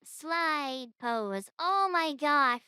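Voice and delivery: slow, high, drawn-out gush. Real, not acted. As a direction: melt over something adorable. Gushing over cute pose.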